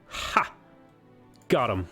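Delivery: ordinary talk, nothing marked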